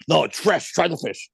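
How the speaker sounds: imitating Capello ordering him to eat the fish; not a question, an order. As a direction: impress the blunt insistence of the coach, lightly voiced. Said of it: "it wasn't like a question".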